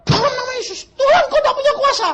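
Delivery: very high mock wailing shout; desperate prayer. Pastor pleading with God before robbers, desperate high cry.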